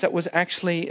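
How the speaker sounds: ordinary talk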